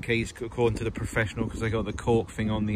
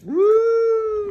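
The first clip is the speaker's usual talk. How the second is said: excited whoop; 7 dB louder than usual. Real, not acted. Excited cheer while filming friends.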